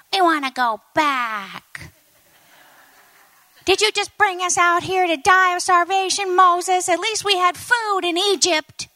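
high-pitched, hammed-up grumble; mock complaining. Freed Israelites grumbling to Moses in the wilderness, high mock complaint.

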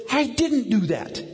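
put-on high, slow kid protest; imitated child's denial. Son denying he smiled, high mock child voice. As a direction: deny it like a small boy.

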